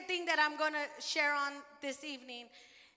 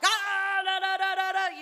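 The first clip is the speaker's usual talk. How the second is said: higher, louder hammed-up prayer babble; mock loud praying; 4 semitones higher, 8 dB louder than usual. Preacher imitating loud church prayer, mock loud babble. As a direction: imitate the loud pray-ers, playfully. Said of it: "I'm not the one that prays, like, super loud".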